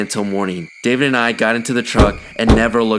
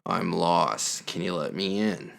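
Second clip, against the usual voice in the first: low, softer, one-note drone; low monotone; 3 semitones lower, 11 dB quieter, slower than usual. Retold or imitated. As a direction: say it low and lifeless, no tune. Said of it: "repeated the same phrase".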